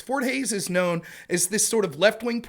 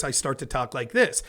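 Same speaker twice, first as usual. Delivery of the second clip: a little faster than normal talk; quicker debate pace; 7 semitones lower, 4 dB quieter than usual. Shown as a demonstration. Debater squeezing in more arguments, talking quicker. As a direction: step up the speaking pace. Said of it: "instead of talking like this".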